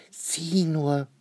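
a high, amazed little cry from Franzi; child pointing it out in surprise; surprised. Acted. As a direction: sound like she just spotted something odd. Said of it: "sagt Franzi erstaunt".

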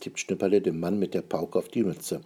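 ordinary delivery, nothing marked

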